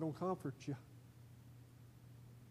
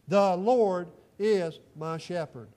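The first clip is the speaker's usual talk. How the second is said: slow loud recited verse; 8 dB louder, much slower than usual. Shown as a demonstration. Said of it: "Say that with me if you would".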